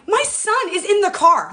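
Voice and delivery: high, loud, distressed plea; getting emotional. Real, not acted. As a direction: plead with real emotion welling up. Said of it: "I'm getting emotional".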